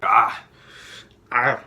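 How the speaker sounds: loud gasps of burning pain. Real, not acted. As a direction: gasp from the burn. Burning mouth from spice.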